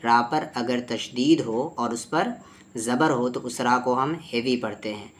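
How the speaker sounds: usual speaking voice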